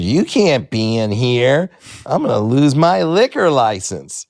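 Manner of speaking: imitated club owner. Club owner to underage comic, stern mock objection. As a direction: play a worried owner laying down the law.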